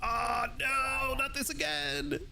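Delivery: high, drawn-out wail of dismay; mock dismay. Real, not acted. Streamer groaning at a dreaded task, dismayed. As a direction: stretch out a high "oh, no".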